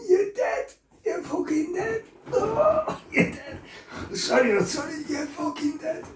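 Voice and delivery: high strained threat broken by laughter; laughing threat. Retold or imitated. From the floor he threatens his brother, high strained half-laughing. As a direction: vow revenge while you can't stop laughing.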